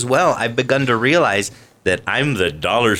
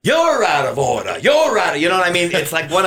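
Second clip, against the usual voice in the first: Al Pacino voice; 5 semitones higher than usual. Shown as a demonstration. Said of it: "Al Pacino".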